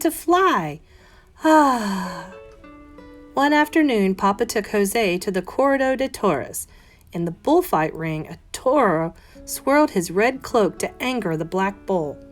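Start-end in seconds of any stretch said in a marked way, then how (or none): none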